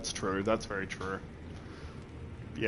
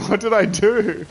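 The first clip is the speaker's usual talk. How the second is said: laughing, louder bewildered question; 7 semitones higher, 5 dB louder than usual. Real, not acted. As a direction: giggle it out in confusion. Laughing at own confusion.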